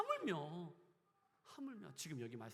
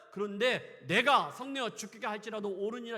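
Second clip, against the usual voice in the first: louder defiant 옳으니라 said at full force; loud stubborn reply; 5 semitones higher, 13 dB louder than usual. Acted. Jonah answering God, loud and defiant. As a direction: hurl it back defiantly. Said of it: "하나님 말씀하시잖아요".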